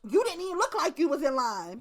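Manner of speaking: ordinary talk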